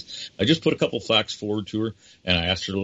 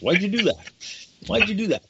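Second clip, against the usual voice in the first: mock exasperation; 12 semitones higher than usual. Real, not acted. Host playfully scolds the guest.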